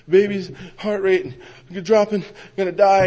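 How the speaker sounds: high, broken-up retold panic; panicked blurting. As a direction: force out the news in fragments. Retells panicked plea to sponsor.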